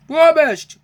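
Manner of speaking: high, loud indignant huff; loud, high dismissive scoff. A narrator's reading. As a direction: scoff it out like a proud engine. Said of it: "huffed Henry".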